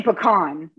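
"pecan" said puh-KAHN; "pecan" with a quick puh, then KAHN. Shown as a demonstration. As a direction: say "pecan" with stress on an open "kahn". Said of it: "I say pecan".

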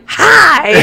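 high, very loud hi; loud goofy hi. Retold or imitated. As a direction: yell a goofy hi into her face. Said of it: "You're like".

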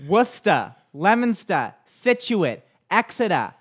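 WUSS-tuh, LEM-in-stuh, SIT-choo-it, EX-it-uh for Worcester, Leominster, Situate, Exeter; Worcester, Leominster, Situate, Exeter with dropped final r's and squeezed syllables; local pronunciation demo. Shown as a demonstration. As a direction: recite Worcester as WUSS-tuh and the rest locally, each word separate. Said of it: "you're saying it all wrong".

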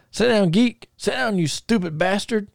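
high-pitched imitation with a country accent. Wrestler telling off a fan in the crowd, mock country accent. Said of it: "he, in his country accent".

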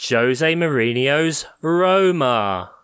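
normal talking voice

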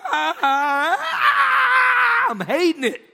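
rising pained cry, climbing high and loud. Retold or imitated. Student with needle in arm, climbing loud pained cry. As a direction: yelp as the needle digs around.

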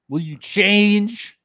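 whiny, high and drawn-out plea; mock whiny plea. Retelling Gilmer's plea to Kara, a mock whiny plea. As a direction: stretch it out like a pleading fiancé.

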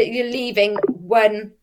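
ordinary talk, nothing marked

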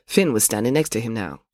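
ordinary delivery

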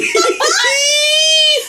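very high squeal of laughter; helpless high cackle; shrieking laugh. Real, not acted. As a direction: laugh until it turns into a squeal. Cracking up at the rap.

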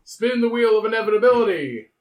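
loud game show announce; announcer voice. Acted. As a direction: boom it like a game host. Host announces the wheel.

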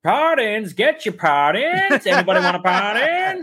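high vendor hawking call; ballpark vendor. Retold or imitated. Imagining a ballpark, vendor hawking hot dogs. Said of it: "someone's like".

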